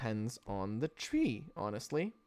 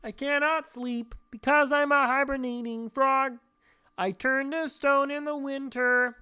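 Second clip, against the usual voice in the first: high, loud puppet frog; a sleepy frog explaining himself; 10 semitones higher, 5 dB louder than usual. Pretend. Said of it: "I get stuck in the voice".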